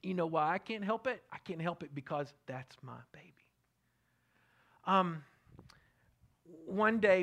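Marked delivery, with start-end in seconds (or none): 1.3-3.3 whisper. Real, not acted. Tender aside about his child.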